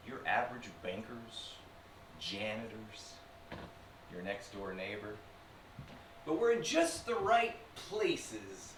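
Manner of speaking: usual speaking voice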